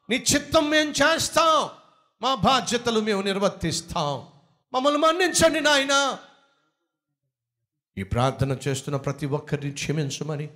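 earnest pleading prayer to the Father; fervent prayer. Acted. Preacher leading the church in a pleading prayer. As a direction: beg forgiveness for the congregation.

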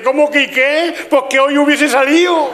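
high, mock-tearful husband wailing; llanto fingido. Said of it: "Pero a lágrima viva, así, mirar". (marido llorón, exagerado y agudo)

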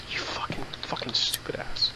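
breathy whispered jab; whispered insult. Real, not acted. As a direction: hiss the insult under your breath. Muttered insult.

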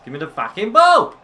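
high, loud demand climbing to BALL; frustrated yell. Real, not acted. Player chasing the ball, frustrated yell. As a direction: yell for it, out of patience.

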